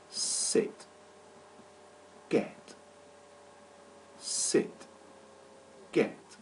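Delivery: Sit and Get each clipped to one short beat; one syllable. Shown as a demonstration. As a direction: tap out Sit and Get as single beats. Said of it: "Both sit and get have one syllable".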